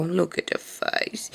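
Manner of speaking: soft whisper over the sleeping dog; hushed aww at a sleepy pet; whispered coo. Real, not acted. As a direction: breathe it, slow and tender.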